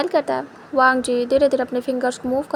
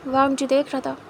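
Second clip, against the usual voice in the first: plain reading voice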